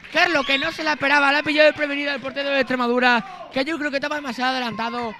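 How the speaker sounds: loud, high excited goal call; breakneck goal commentary. Real, not acted. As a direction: ride the goal euphoria. (narración eufórica del golazo)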